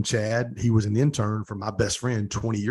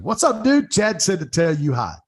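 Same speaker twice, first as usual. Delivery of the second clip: much higher and louder, a hammed-up cheerful hello; excited greeting; 7 semitones higher, 6 dB louder than usual. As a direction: retell a bouncy, buzzing hello to a friend. Retold greeting to Nate on FaceTime.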